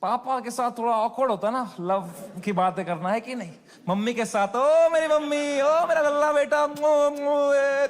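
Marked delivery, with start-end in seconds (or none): 4.5-7.9 higher, louder doting mother voice; doting mom. Retold or imitated. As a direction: coo over the child like a fond mother. Imitating mom's doting voice.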